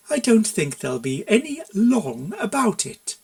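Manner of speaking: slow, sugary threat; sweetly menacing. A narrator's reading. Mare sweetly threatening the consultant, slow sugary menace. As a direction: smile sweetly while you threaten him. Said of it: "She smiled sweetly as she said it".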